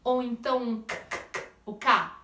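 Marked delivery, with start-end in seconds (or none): 1.0-1.5 breathy voiceless K clicks, no voice. Shown as a demonstration. Teacher demos voiceless K to students. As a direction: spit out K with air only. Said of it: "Não tem voz aí".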